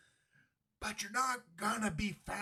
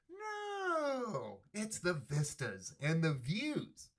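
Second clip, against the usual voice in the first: mock denial; 2 semitones higher, much slower than usual. Host scoffing at oil and lithium motives, mock denial.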